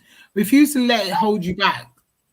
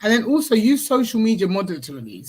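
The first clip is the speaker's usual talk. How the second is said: ordinary delivery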